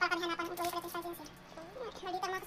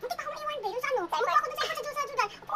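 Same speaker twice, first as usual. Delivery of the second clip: normal talking voice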